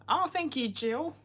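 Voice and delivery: ordinary talk, nothing marked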